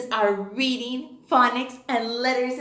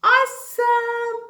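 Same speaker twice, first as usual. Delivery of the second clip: high, loud, drawn-out awesome; excited praise; 10 semitones higher, 6 dB louder than usual. Shown as a demonstration. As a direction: cheer the kids on. Teacher praising kids loudly.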